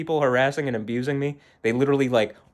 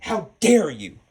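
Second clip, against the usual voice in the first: a scornful, raised-pitch imitation; mocking Greta Thunberg; 5 semitones higher, slower than usual. Daughter imitating mom's outburst, mock indignation. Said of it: "Okay, Greta Thunberg or whatever your name is".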